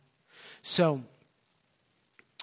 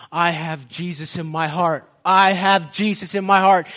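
building from raised voice to loud cry; jubilant declaration; 3 semitones higher, 11 dB louder than usual. Retold or imitated. Preacher voicing a tearful man jumping up and down, jubilant. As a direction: repeat it louder, swelling with joy. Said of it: "jumping up and down".

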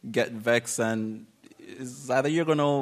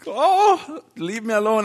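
high, loud tantrum whine; child's tantrum; 8 semitones higher, 5 dB louder than usual. Retold or imitated. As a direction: whine like a sulking child throwing a fit. Retold tantrum, whining at God.

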